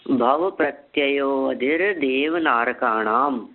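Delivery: the whole sutra drawn out, word by word; very slow recitation. Shown as a demonstration. Teacher reciting the full sutra slowly for memorising. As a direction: chant it slowly so it can be memorised. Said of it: "देखिए बहुत धीरे धीरे बोलता हूँ".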